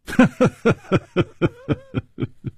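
airy high-pitched chuckle; light laugh. A narrator's reading. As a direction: laugh it off at ease. Carefree hero laughs.

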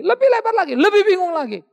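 high and loud, building the sermon; climbing preacher cry. Acted. Preacher to congregation, high ringing climax. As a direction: push it up to a ringing peak.

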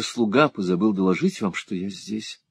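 ordinary delivery

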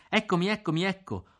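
shouted with pain. A narrator's reading. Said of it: "Io gridai".

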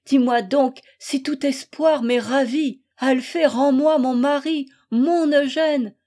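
impassioned begging; desperate plea. Acted. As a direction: implore with rising anguish. Wife begs rival for husband.

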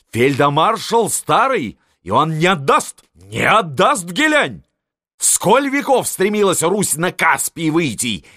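high loud furious tirade; old marshal railing at the empress; raging. A narrator's reading. Marshal orders prince, raging. (яростно, высоким криком)

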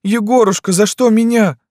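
high, loud plaintive wail; tearful girl. A narrator's reading. Wounded Lyuska asks Yegor why, weeping. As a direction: plead in a high girlish cry. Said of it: "заплакала Люська".

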